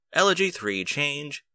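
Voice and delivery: ordinary delivery, nothing marked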